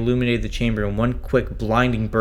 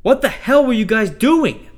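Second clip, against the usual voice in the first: much higher, louder, slow angry demand; angry shout; 13 semitones higher, 6 dB louder than usual. Acted. Rescued astronaut shouting at crew for the lockout. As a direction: shout the accusation at them. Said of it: "I shouted".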